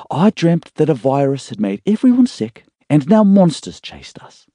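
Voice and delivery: a hint of a groggy young woman just waking; young woman's voice. A narrator's reading. Emma voiced, higher.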